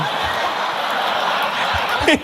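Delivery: breathless wheezing laugh. Real, not acted. Laughing at man falling.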